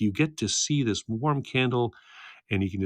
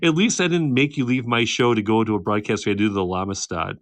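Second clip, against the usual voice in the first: ordinary delivery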